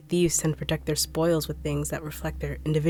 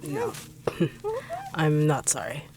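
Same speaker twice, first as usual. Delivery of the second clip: mocking laugh. Villain reveals cruelty.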